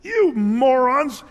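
loud, high, drawn-out shout; angry yell. Retold or imitated. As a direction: yell the insult slowly, in exasperation. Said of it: "he yells at them".

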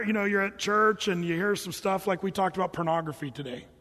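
ordinary talk

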